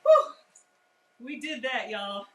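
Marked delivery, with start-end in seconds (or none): 0.0-0.3 loud whew of relief. Real, not acted. Relief after finishing meal.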